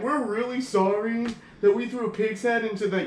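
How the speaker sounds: high-pitched, simpering sorry act; mock apology. Band apologising to vegans, in mocking imitation. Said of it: "they specifically apologize to, like, vegans".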